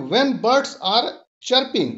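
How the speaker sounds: slow recitation of "It tickles my bones"; measured, unhurried poem reading; poem recital. Shown as a demonstration. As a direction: savour each line of the poem for the class. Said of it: "दूसरा स्ट्रंजा है कि".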